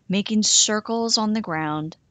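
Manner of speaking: plain reading voice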